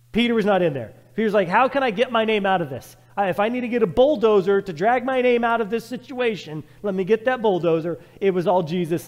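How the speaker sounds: loud, rising preacher cry; fiery preaching. Acted. Preacher rebuking the crowd from the pulpit, loudly. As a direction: call out the crowd's hypocrisy.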